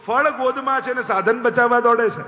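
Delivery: mock-angry divine child; indignant anger. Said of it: "પ્રભુને આવ્યો ક્રોધ".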